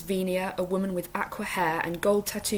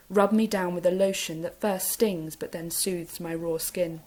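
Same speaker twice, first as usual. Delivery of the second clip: ordinary delivery, nothing marked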